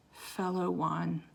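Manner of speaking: slow, very soft and tender; soft reply. Acted. Wizard answering his long-lost sister, soft and tender. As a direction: answer gently, close to her. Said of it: "answered her softly".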